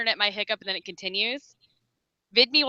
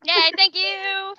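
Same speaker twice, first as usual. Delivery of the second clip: high, drawn-out yay and thanks; slow, raised-pitch happy thank-you; excited thanks; 8 semitones higher, much slower than usual. Real, not acted. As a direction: stretch it out, delighted and grateful. Thanks a new subscriber.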